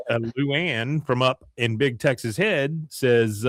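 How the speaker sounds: ordinary delivery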